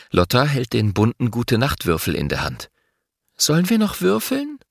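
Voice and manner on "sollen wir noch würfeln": put-on high girl's voice, hopeful; little girl. A narrator's reading.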